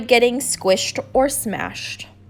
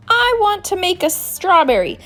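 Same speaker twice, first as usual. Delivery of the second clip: lightly raised, chirpy flower voice; a flower calling out for help; pleading flower; 8 semitones higher than usual. Acted. Flower asks the bees for pollen, lightly chirpy.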